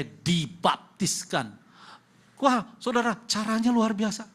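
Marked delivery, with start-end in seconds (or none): 2.4-4.3 loud, much higher burst of wonder; amazed exclamation. Real, not acted. Preacher tells the congregation how amazing it was, excited. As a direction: marvel at it out loud.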